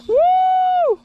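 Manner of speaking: loud high whoop; excited woo. Real, not acted. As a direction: let out a big whoop. Fish on, cheering.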